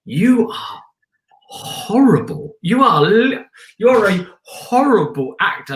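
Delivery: high, drawn-out mock self-disgust; berating oneself. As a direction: scold yourself slowly, hitting HORRIBLE hard. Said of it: "was looking back at myself going".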